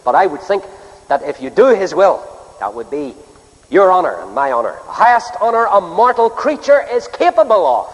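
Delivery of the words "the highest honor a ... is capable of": emphatic preaching. Real, not acted. Preacher proclaiming the highest honor to the congregation, emphatic preaching. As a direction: lift the pitch and proclaim it grandly.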